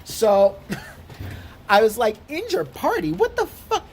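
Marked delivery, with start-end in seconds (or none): 2.3-3.8 shocked disbelief. Retold or imitated. Reading the letter about the injured party, outraged shock. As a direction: voice jumps much higher in outrage.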